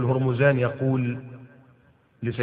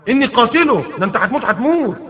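much higher, louder angry threat; furious vow; 9 semitones higher, 4 dB louder than usual. Acted. Umar to the prisoner, loud furious threat. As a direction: hurl the threat at him. Umar angrily vowing death.

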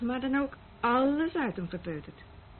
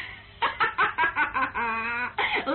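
nervous laugh; 3 dB louder than usual. Acted. Laughs mid complaint.

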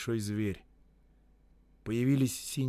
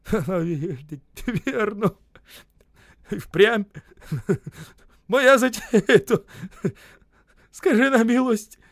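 high loud half-laughing babble; high loud laughing gabble; laughing or crying; 10 semitones higher, 9 dB louder, much slower than usual. A narrator's reading. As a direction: laugh it out near tears. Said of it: "смеясь или плача, стал бормотать".

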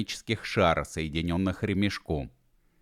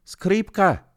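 very high, loud wail; anguished cry; 13 semitones higher, 5 dB louder than usual. Acted. Gedevan wails over the smashed violin, high anguished cry. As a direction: mourn the violin in one cry.

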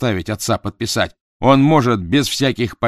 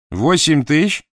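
high, louder disbelieving question; surprised; 8 semitones higher, 6 dB louder, slower than usual. Acted. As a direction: echo the sum in disbelief. Dot learns of the lie.

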